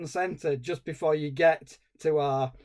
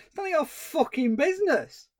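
high, swooping, exasperated comeback; mock indignant retort; 11 semitones higher than usual. [name] answering the nosy nephew, mock indignant. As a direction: act out an outraged put-down, playfully overdone.

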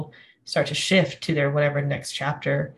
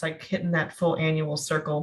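ordinary talk

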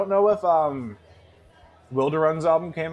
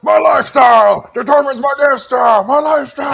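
hammed-up shouting rant, much higher and louder; mock rant shout; 12 semitones higher, 13 dB louder than usual. Friend bursts into a mock rock-star rant, shouting. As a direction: bellow it like a ranting rock star.

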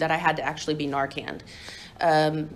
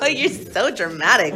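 laughing repeat; 3 semitones higher, 3 dB louder than usual. Retold or imitated. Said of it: "repeats the quote, laughing".

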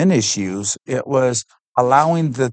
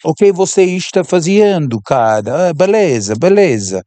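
put-on higher, louder kid-like Portuguese; a touch of a kid's voice in Portuguese; little street kid Portuguese; 4 semitones higher, 3 dB louder than usual. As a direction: chatter like a Brazilian street kid. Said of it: "I sound like a little kid".

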